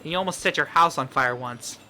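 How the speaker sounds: usual speaking voice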